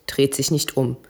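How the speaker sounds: ordinary delivery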